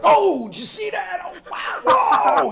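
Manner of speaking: high, loud, excited yelling; imitating his dad howling at the screen; dad freaking out. Imitating his dad shouting in the theater during The Thing, high and loud. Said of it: "And he'd be sitting there like".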